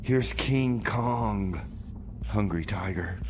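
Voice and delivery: ordinary talk, nothing marked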